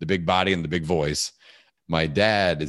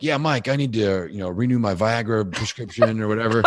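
imitated phone caller; 5 semitones higher, 3 dB louder than usual. Voices caller mistaking boy for dad.

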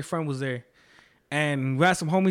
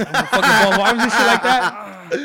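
laughing brag; 4 semitones higher, 9 dB louder than usual. Real, not acted. Joking about his figure.

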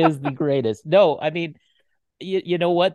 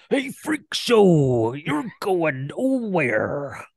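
very slow, higher, drawn-out wrestler growl; Macho Man impression; 5 semitones higher, much slower than usual. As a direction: stretch each word like a larger-than-life wrestler. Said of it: "I love doing his lines from Spider-Man".

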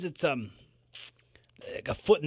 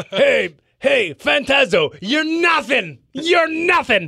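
loud, high heel promo; wrestling promo; 7 semitones higher, 4 dB louder, slower than usual. Pretend. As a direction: call him out like a heel.

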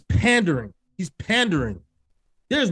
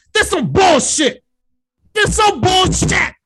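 slow, high, louder disgust; angry rant; 11 semitones higher, 5 dB louder, slower than usual. Real, not acted. Fed-up listener ranting about [name]'s album, loud and slow. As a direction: spit out the verdict slowly.

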